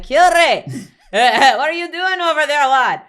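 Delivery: put-on high, loud Scottish accent, calling the dog; big comic Scottish shout at a dog; Scottish accent yell. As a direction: holler in a hammed-up Scottish accent, laughing. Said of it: "yelling at my dog in a Scottish accent".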